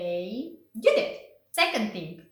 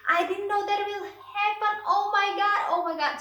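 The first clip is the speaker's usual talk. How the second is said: much higher, loud frantic apology; panicked apology; 10 semitones higher, 3 dB louder than usual. Retold or imitated. Anesthesiologist apologizing to the patient, high and panicked. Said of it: "the anesthesiologist was freaking out".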